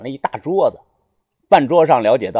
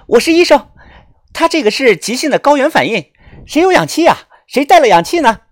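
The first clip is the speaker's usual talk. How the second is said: high, raised urgent female doctor voice; loud announcement; 10 semitones higher, 5 dB louder than usual. A narrator's reading. Young doctor asking bystanders for oxygen, loud and urgent. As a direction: call out urgently to a crowd. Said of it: "立即大声的说".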